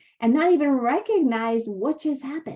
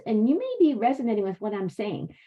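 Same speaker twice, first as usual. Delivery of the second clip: ordinary talk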